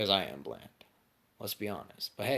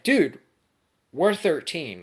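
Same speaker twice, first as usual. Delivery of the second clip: much higher and louder, shocked protest; shocked outburst; 8 semitones higher, 10 dB louder, slower than usual. Retold or imitated. Friends protesting to Austin, shocked and much louder. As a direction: blurt it out in disbelief.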